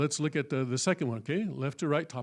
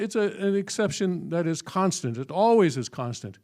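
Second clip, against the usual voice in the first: ordinary talk, nothing marked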